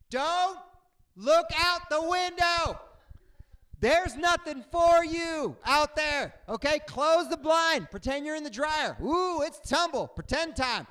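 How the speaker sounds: high, loud, slow emphatic warning; emphatic advice. Shown as a demonstration. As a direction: plead slowly with a scared flyer. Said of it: "let me tell you something".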